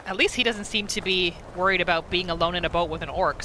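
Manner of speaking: usual speaking voice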